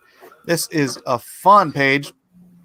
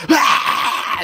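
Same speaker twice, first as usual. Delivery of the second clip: mock scream; 11 semitones higher, 4 dB louder than usual. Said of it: "then he's like".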